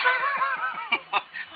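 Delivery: high-pitched giggle at the memory; high laugh. Real, not acted. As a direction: laugh at yourself. Laughing at lost throwing star.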